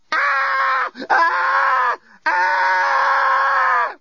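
high piercing repeated screams; primal scream. Acted. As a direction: scream in sheer panic. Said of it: "primal scream mode".

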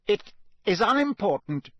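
high-pitched, icy dismissal; cold, emphatic brush-off. A narrator's reading. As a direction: dismiss it coldly and firmly. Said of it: "said Snape coldly".

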